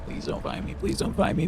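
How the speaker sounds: breathy whispered plea to the monster; whispering while hiding. Real, not acted. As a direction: whisper it so the monster won't hear. Hiding, pleading quietly.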